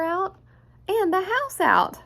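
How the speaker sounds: storyteller building the eraser's frenzy; rising alarm. A narrator's reading. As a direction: climb to a peak of alarm.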